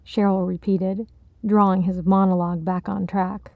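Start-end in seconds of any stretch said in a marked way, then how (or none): none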